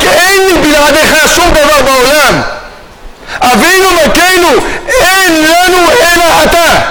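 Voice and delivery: high, impassioned plea; crying out. Retold or imitated. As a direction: cry out in total dependence. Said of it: "אם הוא זועק".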